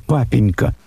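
high, begging voice near tears; plaintive pleading. A narrator's reading. Daughter begs father not to marry her off, pleading. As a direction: beg him not to ruin her. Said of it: "жалобным голосом".

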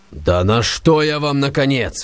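slow, exasperated outburst; Stavrogin's angry bewilderment; angry astonishment. A narrator's reading. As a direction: demand an answer, baffled. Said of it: "в решительном гневе и изумлении".

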